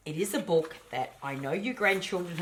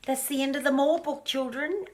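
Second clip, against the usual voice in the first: fond address; 10 semitones higher than usual. Real, not acted. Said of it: "grandmother addressing grandchildren".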